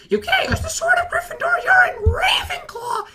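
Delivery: put-on indignant squeaky kid shriek; Harry Potter. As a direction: protest in a very high, squealing voice. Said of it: "he just goes".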